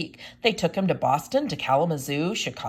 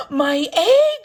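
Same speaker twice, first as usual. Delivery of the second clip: high, loud joyful shout; Horton's high excited shout; 11 semitones higher, 9 dB louder than usual. A narrator's reading. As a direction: cry out in amazement. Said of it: "shouted Horton".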